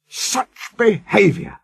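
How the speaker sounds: high, emphatic telling-off; scolding. A narrator's reading. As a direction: scold the naughty coaches. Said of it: "he scolded".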